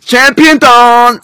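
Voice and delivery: slow, loud, high announcer call; hype shout. Acted. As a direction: announce it like a champion. Hyping the champion dance.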